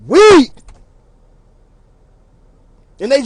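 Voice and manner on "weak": loud, high punchline; loud high shout. Real, not acted. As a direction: belt out the last word.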